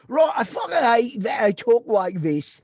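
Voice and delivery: slow, loud mock-angry English accent shout. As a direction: yell it in a hammed-up English accent. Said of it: "all mad".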